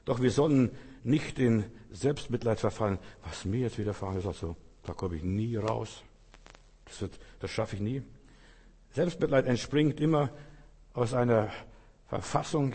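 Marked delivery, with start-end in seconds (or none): 3.2-8.0 softer, sunk-in-self-pity murmur; quiet whiny self-pity; self-pitying mutter. Acted. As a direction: sigh it out to yourself, defeated. Self-pitying inner voice quoted.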